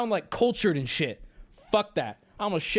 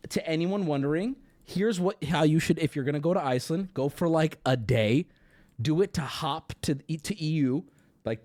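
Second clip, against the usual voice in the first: usual speaking voice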